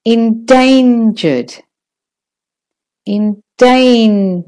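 slow "endangered" with a long DANE; stress on DAN. Shown as a demonstration. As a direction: stretch the middle of "endangered". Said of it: "You can hear that's longer".

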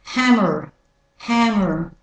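American "hammer" with a sounded final R; crisp American-accent word demo. Shown as a demonstration. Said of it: "And the American accent".

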